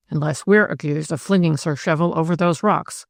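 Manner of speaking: ordinary delivery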